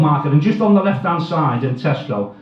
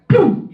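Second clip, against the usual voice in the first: imitated price gun zap; 12 semitones higher than usual.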